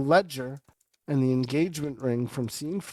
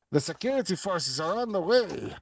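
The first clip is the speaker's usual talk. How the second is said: lightly voiced dockmaster; 6 semitones higher than usual. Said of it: "he messages the crew".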